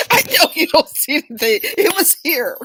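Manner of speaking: laughing protest. Retold or imitated. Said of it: "I'm like".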